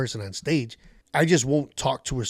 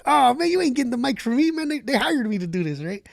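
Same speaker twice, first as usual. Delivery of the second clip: high, teasing keep-away; playful dodge; 11 semitones higher than usual. Retold or imitated. Comic to district manager, teasing keep-away. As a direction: taunt while backing away. Dodging manager taking the mic.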